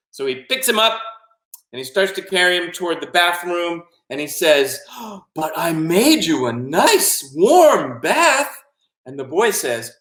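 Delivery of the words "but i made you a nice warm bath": slow, higher, swooping singsong; coaxing octopus. Acted. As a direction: coax sweetly, drawing out each word. Octopus coaxing the boy to bathe.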